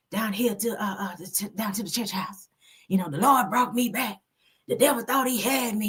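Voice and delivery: put-on frail preacher, rushed thanks then rambling; Eddie Long. Host mimics the preacher saying the Lord brought him back, imitated frail voice. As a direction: imitate a weakened preacher testifying in an odd small voice. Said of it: "That's how he was talking".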